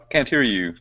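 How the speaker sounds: normal talking voice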